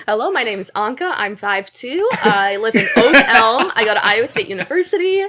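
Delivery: laughing through a mock self-introduction; giggling mock freshman introduction. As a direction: parody your old eager intro while cracking up. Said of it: "Oh, you have a fully developed sense".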